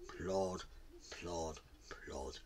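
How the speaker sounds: low, soft, spaced-out plods; hushed trudging rhythm with pauses; slow plodding. A narrator's reading. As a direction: drop each word like a heavy footstep. Imitating tortoise's slow trudge.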